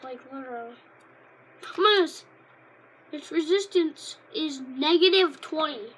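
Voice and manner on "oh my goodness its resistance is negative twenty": mock outrage. Real, not acted. Kid reviewing a weak card, loud disbelief. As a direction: gasp at how bad the card is. Shocked at stats.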